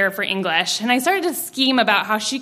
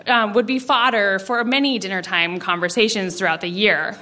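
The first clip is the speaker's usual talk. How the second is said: usual speaking voice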